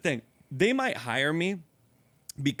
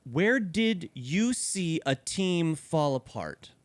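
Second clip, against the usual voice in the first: slow, drawn-out reading; 3 semitones higher, much slower than usual. Shown as a demonstration. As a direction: draw the question out slowly. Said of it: "question number three".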